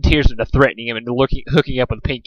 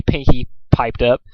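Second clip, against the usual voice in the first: usual speaking voice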